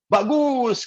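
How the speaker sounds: high-pitched mock praise; pujian tiruan. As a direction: exclaim approval, pitched well up. Priest's retold praise to child. (pujian bernada tinggi)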